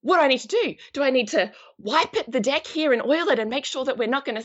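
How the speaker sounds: high-pitched, flustered protest; mock panic, questions tumbling out; flustered husband. Husband just handed the rudder, flustered and unsure. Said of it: "He's gonna be like".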